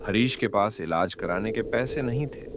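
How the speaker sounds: ordinary delivery, nothing marked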